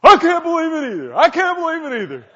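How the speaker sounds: very high, loud squeal of disbelief; brother's mock disbelief. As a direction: play the brother shocked. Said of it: "He said".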